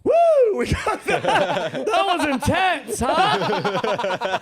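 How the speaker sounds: high, elated whoop into drawn-out words; hammed-up relieved celebration; whooping pilot. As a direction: imitate a pilot thrilled to have survived. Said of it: "The pilot comes on".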